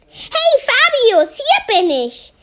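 high, bright call across the reef. Acted. Mermaid calls to Fabius from her hiding spot, calling out high. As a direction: call out playfully to a friend.

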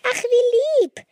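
very high, loud gushing thanks; touched delight. A narrator's reading. Girl thanking brother for cake, delighted squeal. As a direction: coo it out loudly, way up high.

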